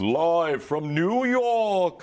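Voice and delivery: slow booming announcer call. Retold or imitated. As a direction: stretch it like the SNL opener. Imitating Don Pardo's famous line.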